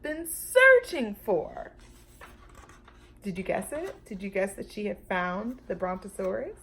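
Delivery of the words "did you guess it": usual speaking voice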